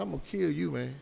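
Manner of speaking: usual speaking voice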